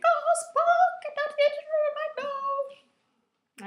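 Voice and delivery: a squeaky tearful plea; wrong Scotty voice. Retold or imitated. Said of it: "Actually, he's Scottish, so he doesn't sound anything like that".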